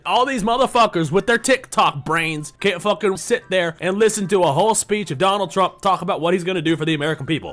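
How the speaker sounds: loud, fast heated rant; angry rant. Real, not acted. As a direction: tear into them, loud. Ranting about TikTok brains.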